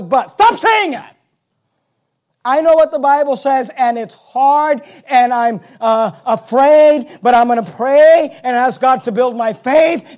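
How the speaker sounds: high, loud pulpit preaching; full-voiced revival sermon; fiery preaching. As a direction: preach it hard to the congregation.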